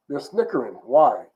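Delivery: ordinary talk, nothing marked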